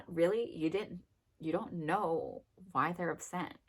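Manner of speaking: normal talking voice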